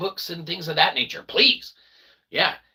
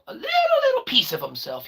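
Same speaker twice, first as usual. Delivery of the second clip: squeaky mock voice; 12 semitones higher, faster than usual. Mock-creepy repeat about Joey.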